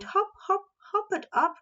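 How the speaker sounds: high, bouncy hop, hop. A narrator's reading. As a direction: make it hop. Narrator mimics hopping.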